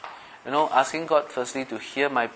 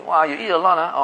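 higher, louder teasing; mock teasing remark; 6 semitones higher, 5 dB louder than usual. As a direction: poke fun lightly. Mocking shallow chit-chat.

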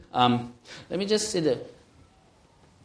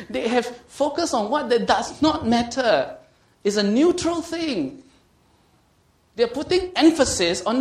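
raised-pitch, driving sermon voice; insistent preacher, higher and forceful; emphatic preaching; 9 semitones higher, 5 dB louder than usual. Real, not acted. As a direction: drive the point home to a congregation.

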